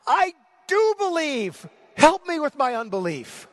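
crying out high and loud, words stretched; a father's anguished plea, full of pathos; desperate cry. Acted. Father crying out to Jesus for help, desperate. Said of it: "There's desperation in his voice".

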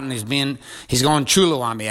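usual speaking voice

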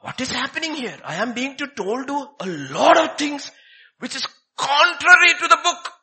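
a priest crying out, aghast at what he reads; voicing Luther's outrage. Luther crying out against the church, rising alarm. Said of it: "he said".

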